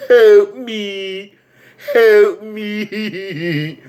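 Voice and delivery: high, drawn-out pitiful plea; shrinking clown. Acted. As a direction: whine it like a tiny dying creature. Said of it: "And he's like".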